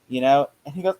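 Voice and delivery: ordinary delivery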